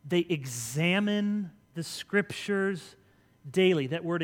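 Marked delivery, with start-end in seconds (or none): none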